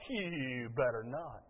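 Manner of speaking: low, slow, soft threat; quiet, drawn-out menace; warning. Acted. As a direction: stretch it out like a parent's warning. Acted parental warning.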